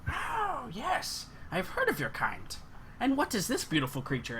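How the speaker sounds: high, lightly voiced old lady, curious and softened; old seamstress voice. Old seamstress curiously greeting the halfling and his bird, a light high old lady voice. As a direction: sweeten up as a kindly old shopkeeper.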